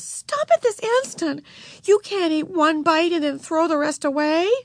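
high, scolding command; stern order. A narrator's reading. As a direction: scold a little sister, voice pitched high. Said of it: "ordered Beezus".

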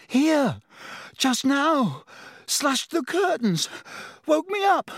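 high-pitched panicked exclamations; gasped-out alarm, pitched very high; terrified. A narrator's reading. Ron telling roommates of the intruder, terrified. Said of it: "a look of utmost terror".

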